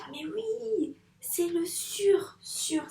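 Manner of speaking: high little voice of sudden recognition; child's eureka. Pretend. Teacher voicing a pupil's aha moment. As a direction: play a child who suddenly gets it.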